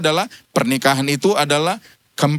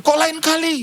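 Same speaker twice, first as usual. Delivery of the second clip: incredulous retort; 12 semitones higher than usual. Retold or imitated. Friend's surprised retort.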